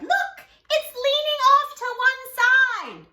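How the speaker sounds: put-on high, loud alarmed child shout; children crying out. Rabbit children cry out that the tree leans, high and loud. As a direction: yell out in alarm. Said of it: "the children cried".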